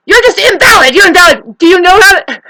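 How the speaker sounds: high, loud mock tirade; ranting narcissist. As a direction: shout them down like an indignant narcissist. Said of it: "Narcissists hate sayings. They say".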